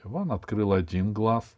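plain reading voice

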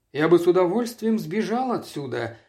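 ordinary delivery

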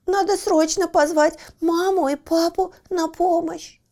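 high, loud urgent little pup; a worried pup thinking fast; anxious puppy voice. Acted. Лаки вспоминает секретное слово. (взволнованный щенок)

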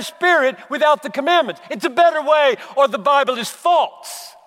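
loud, high, impassioned pulpit preaching; preaching at full force. Acted. Pastor urging his congregation toward the commands, preaching at full force. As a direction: pound the point home to the congregation.

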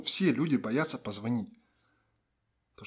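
usual speaking voice

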